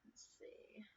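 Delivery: whispering. Real, not acted. Thinking to herself.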